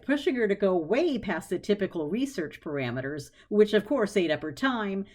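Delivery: ordinary delivery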